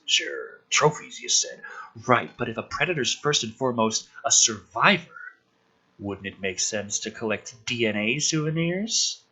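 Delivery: hushed, low read-through of the film scene; quiet script reading. Acted. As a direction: murmur the characters' lines low.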